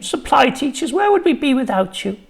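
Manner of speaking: high, busy office lady; fussy secretary voice. A narrator's reading.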